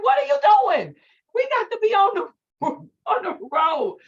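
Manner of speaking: high, loud exasperated scolding; livid. Retold or imitated. Daughter hurrying her father to graduation, livid and loud. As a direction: re-enact hollering at a dawdling father. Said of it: "we were late and I was livid".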